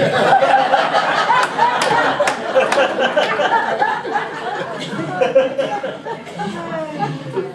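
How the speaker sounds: extended high-pitched cackling; long high laughter. Real, not acted.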